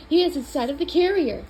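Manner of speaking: ordinary delivery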